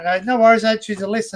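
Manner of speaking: cheeky quip to a customer; joking reply. Retold or imitated. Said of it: "I jokingly just say back".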